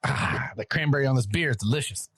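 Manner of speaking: usual speaking voice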